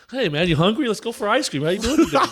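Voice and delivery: high, bright mock buddy voice; friendly coach after. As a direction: switch to sudden chummy kindness. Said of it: "it's like".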